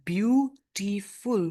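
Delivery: "beautiful" as three separate beats; syllable by syllable. Shown as a demonstration. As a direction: sound out "beautiful" slowly with gaps between syllables. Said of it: "We are taking three breaths together".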